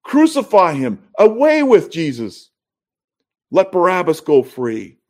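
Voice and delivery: high-pitched, forceful crowd cry; imitated mob shouting. Crowd rejects Jesus before Pilate, imitated high shouting. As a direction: call it out high like a crowd demanding a verdict. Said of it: "Now they're shouting".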